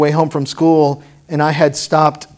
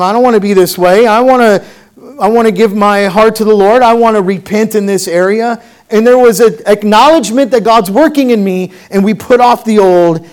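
higher, louder pleading sermon voice; impassioned preaching; 5 semitones higher, 6 dB louder than usual. Real, not acted. Preacher voicing a believer's vow to repent, impassioned preaching.

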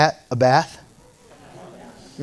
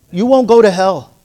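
ordinary talk

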